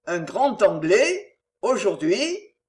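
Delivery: slow, unhurried drawl of the innkeeper; very slowly. Acted. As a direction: take your time over every word. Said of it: "très lentement".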